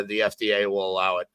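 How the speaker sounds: normal talking voice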